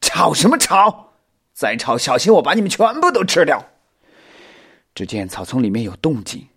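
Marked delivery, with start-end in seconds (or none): 0.0-3.7 loud, raised-pitch scolding; angry threat. A narrator's reading. Spider threatening to eat the noisy youngsters, angry threat. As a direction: snap at them menacingly.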